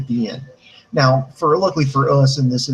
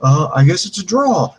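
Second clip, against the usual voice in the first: high, loud mock dithering; clueless official; 3 semitones higher, 4 dB louder, slower than usual. Daniel Bryan calling the match a draw, dithering idiot. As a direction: play the hapless official giving up. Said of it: "he looks like a fucking idiot".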